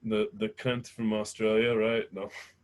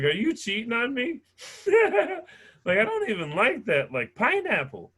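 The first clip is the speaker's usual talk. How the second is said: shocked; 11 semitones higher, 3 dB louder than usual. Retold or imitated.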